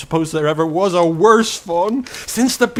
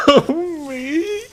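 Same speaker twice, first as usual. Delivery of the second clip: high, shaky-breathed sob through the words; a fawn trying not to weep; a small voice caught mid-cry; tearful fawn; 8 semitones higher than usual. Acted.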